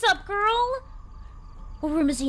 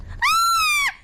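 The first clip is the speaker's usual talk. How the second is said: high, loud shriek of panic; high loud cry of being stuck; panicked shriek; 4 dB louder, much slower than usual. Real, not acted. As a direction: squeal like you're trapped. Game panic.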